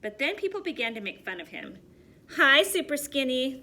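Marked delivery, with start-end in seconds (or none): 2.4-3.6 loud teasing call. A narrator's reading. Kids making fun of Stanley, loud teasing call. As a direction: jeer at him from across the street. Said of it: "they would call".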